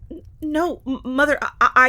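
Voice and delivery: high, loud stuttering panic; panicked stammer. Acted. Lilibet stammering in panic to her mother. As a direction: stumble over the words in fright.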